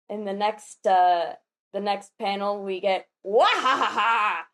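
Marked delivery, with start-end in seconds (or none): 3.2-4.4 theatrical "wahaha" cackle; acted villain laugh. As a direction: laugh it out syllable by syllable, hammed-up. Reading a character's booming laugh.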